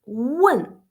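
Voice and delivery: falling-tone WEN, as a teacher models it; demonstrated Chinese tone. Shown as a demonstration. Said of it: "If you pronounce".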